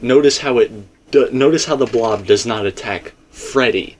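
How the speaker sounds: ordinary delivery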